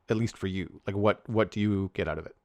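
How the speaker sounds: usual speaking voice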